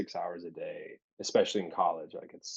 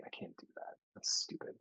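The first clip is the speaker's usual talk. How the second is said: hushed, almost voiceless self-talk; whispered self-doubt; 8 dB quieter than usual. Retold or imitated. Doubting his acting dream, whispered. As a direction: whisper it like a secret you won't admit. Retelling own inner doubt.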